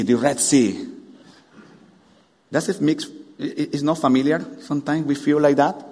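ordinary talk, nothing marked

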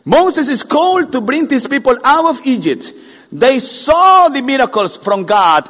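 loud, high preaching; full-force preaching. Acted. Preacher to congregation, loud fervent preaching. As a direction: preach it out to the back row.

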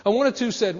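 usual speaking voice

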